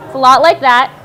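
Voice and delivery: usual speaking voice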